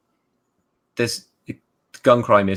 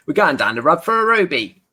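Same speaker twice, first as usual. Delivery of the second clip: broad Cockney, pitched up; thick Cockney accent, a bit higher; 7 semitones higher, 3 dB louder than usual. Shown as a demonstration. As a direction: say it like a Londoner from the East End. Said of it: "let me say that in Cockney".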